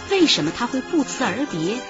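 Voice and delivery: usual speaking voice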